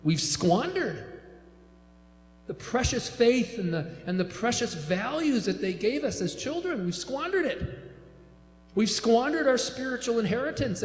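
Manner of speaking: impassioned preaching with pitch climbing high; rising sermon. Acted. Preacher to ex-evangelical congregation, impassioned rising preaching. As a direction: preach it home, building with every squandered.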